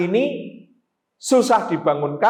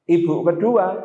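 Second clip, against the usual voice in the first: ordinary talk, nothing marked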